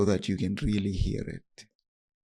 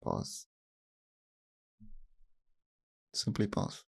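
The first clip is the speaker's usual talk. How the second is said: hushed, almost whispered pause; quiet whispered instruction to pause; soft pause cue; 7 dB quieter than usual. Shown as a demonstration. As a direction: breathe the word pause out softly. Guiding a pause in meditation.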